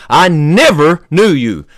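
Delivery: "never" drawn out with a pitch peak; stress on never. Acted. Preacher voicing Jesus turning people away, heavy stress on "never". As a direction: lean hard and slow on "never". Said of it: "I emphasize that word never".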